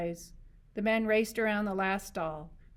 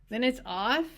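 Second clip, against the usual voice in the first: sulky; 5 semitones higher, 6 dB louder than usual. A narrator's reading. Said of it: "Candy asked sulkily".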